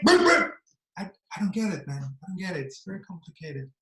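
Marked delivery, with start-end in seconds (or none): 0.1-0.5 high, loud double boop; imitated rap ad-lib as a tic; mock tic noise. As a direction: blurt two yelped boops. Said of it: "all he does is like".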